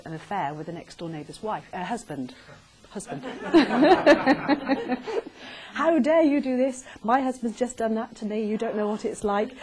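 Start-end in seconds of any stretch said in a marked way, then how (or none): 3.3-5.7 big burst of laughter; loud laugh. Real, not acted. Audience member laughing at the supermarket story, loud laugh. Laugh before acted outburst. 5.7-9.5 hammed-up wounded fury; mock outraged wife. Actress shouting at a supposed husband-stealer in the supermarket, mock outrage. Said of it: "I was acting".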